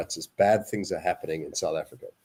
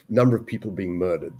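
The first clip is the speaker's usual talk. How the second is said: ordinary talk, nothing marked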